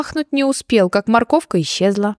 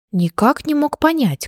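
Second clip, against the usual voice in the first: plain reading voice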